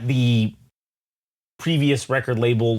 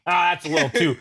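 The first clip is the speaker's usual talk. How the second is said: put-on Texan accent; faster than usual. Speaker mimicking people who find a name too ethnic, Texan accent. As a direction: do a hokey Texan. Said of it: "I don't know why I'm doing a Texan accent".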